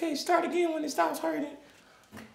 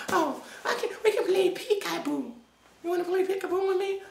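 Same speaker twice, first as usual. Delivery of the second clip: ordinary delivery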